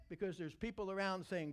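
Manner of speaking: ordinary talk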